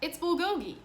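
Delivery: put-on boy explaining his lunch; new boy's voice. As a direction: say it proudly and clearly. New boy names his food.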